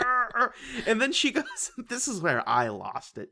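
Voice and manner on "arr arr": mock angry growl. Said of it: "He's like".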